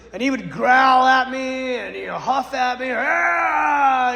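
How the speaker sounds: mock demonic growling shout; retold demon roar; growl and huff. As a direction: huff and snarl like a possessed man. Demon growling at him in story.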